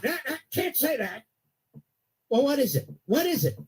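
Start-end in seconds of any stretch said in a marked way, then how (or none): 0.0-1.2 mock scolding. Mocking scolding voice.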